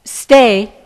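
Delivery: "Stay" said loud with a falling tune; a single loud falling "Stay"; firm command. Retold or imitated. Sage's one-word order that frees the cow, loud command. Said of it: "the moment that he said".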